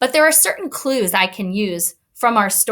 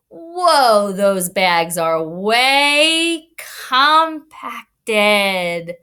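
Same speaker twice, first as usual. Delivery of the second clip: way and compacted dragged out very slowly; slow stretched emphasis; much slower than usual. Shown as a demonstration. Teacher reading compacted to the class, drawn out slowly. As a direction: stretch compacted to show it's squeezed. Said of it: "demonstrates stretched word".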